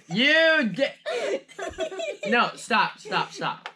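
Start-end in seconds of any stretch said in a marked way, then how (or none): 0.1-1.4 high comedic voice. Acted. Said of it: "It says".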